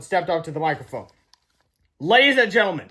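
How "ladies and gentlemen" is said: announcer voice. A narrator's reading. Boys announcing at microphone.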